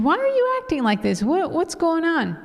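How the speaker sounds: high-pitched, exasperated retold demand; indignant challenge. Wife challenging husband over rudeness, acted indignation. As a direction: act out confronting someone, voice pitched well up.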